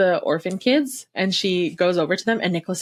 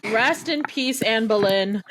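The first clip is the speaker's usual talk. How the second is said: normal talking voice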